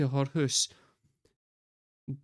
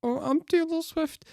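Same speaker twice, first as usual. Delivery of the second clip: high, soft girlish put-on voice; 14 semitones higher, 4 dB quieter than usual. Mocking the girl at the party in a high girly voice. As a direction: squeak it out like a starry-eyed teen singer. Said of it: "She's all like".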